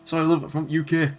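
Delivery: a Leeds lad putting on broad Yorkshire for a laugh; Yorkshire accent. Pretend. Apologizing to a game character in a mock Yorkshire accent. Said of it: "tried to put on a Yorkshire accent".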